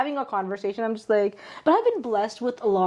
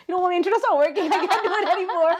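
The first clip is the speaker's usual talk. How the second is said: fast, high-pitched mock excuse; hurried excuse; 8 semitones higher, faster than usual. Nervous interviewee bailing out of a video call, high hurried excuse. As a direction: rush out the excuse, pitched well up.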